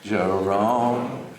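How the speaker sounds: put-on very slow, low Upper East Side accent, "Kern" as "Kine"; Harpo Marx. Son demonstrating his dad's way with names, slow deep Upper East Side accent. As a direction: stretch out "Jerome Kern", Kern turned to "Kehyn", Upper East Side. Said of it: "Jerome Kern the way Harpo would say it".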